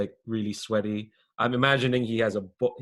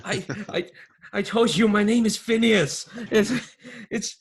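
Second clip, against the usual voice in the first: high, indignant little crook; flustered underling; 11 semitones higher, 6 dB louder than usual. Acted. Underling corrects his boss about his name, flustered. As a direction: protest in a high, loud, stammering voice.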